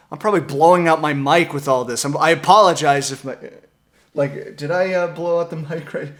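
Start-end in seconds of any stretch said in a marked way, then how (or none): none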